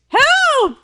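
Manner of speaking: high, loud hammed-up scream; girl screaming for help, hammed up; mock cry for help. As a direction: yell help in mock terror. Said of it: "she's like".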